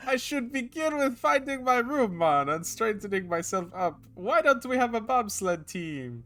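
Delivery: high, slow hammed-up Jamaican accent. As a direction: play a jokey Jamaican, drawn out. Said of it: "The Jamaican accent is great".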